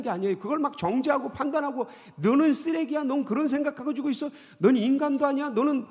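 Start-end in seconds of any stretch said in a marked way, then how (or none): 2.2-5.9 loud, high scolding of yourself; harsh condemnation. Acted. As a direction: hurl the insults like a judge. Condemning self harshly, acted. (큰 소리로 꾸짖듯이)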